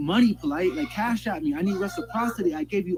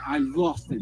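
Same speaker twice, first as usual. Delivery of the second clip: usual speaking voice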